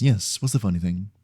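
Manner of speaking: normal talking voice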